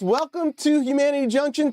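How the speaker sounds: ordinary delivery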